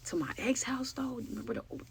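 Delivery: soft, breathy whispered aside. Real, not acted. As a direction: drop to a secret whisper. Aside about the ex's house.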